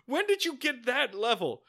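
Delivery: high-pitched incredulous outburst; mock bewilderment. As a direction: act out baffled disbelief, pitch way up. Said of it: "I would've been like".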